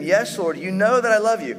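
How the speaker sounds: loud, high, pleading insistence; earnest protest. Acted. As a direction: Peter insisting he loves him. Peter protesting loudly.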